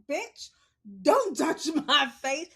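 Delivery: high, loud mock snap; retold childhood defiance, loud and high; imitating a glare. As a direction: snap it back as a fed-up child. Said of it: "I looked at her like".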